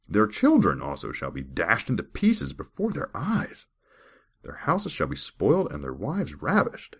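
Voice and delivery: measured, formal Bible reading voice; scripture reading. Acted. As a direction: read the verse like a solemn lesson. Reads Bible verses aloud.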